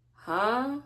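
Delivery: high, incredulous huh; mock surprised huh. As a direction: react in disbelief. Reacting to being told come in.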